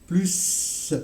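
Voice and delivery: "Plus." with the s drawn out like a ç; plus with a long s. Shown as a demonstration. Teacher showing students a long s in "Plus". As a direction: hold the s of "Plus" like a cedilla. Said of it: "comme si ça serait avec le cède".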